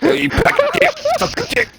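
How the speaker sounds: laughing high-pitched insult. Real, not acted. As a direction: squeal it out through laughter.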